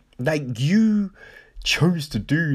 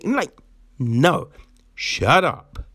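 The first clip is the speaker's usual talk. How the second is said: usual speaking voice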